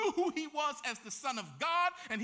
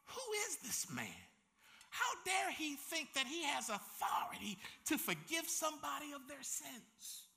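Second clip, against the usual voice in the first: indignant scribes; 4 semitones higher, 5 dB quieter than usual. Acted. Scribes scoff at Jesus.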